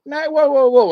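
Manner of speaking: high, loud startled whoa; hammed-up retold shock; shocked protest. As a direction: recoil in disbelief at what he said. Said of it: "And I was like".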